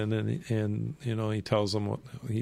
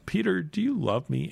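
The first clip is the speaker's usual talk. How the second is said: insistent question; 8 semitones higher than usual. Retold or imitated. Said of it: "the third time Jesus asked him".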